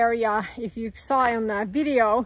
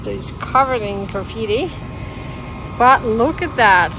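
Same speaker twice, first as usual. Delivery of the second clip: loud; 9 dB louder than usual. Real, not acted. Shouting over wind at ledges.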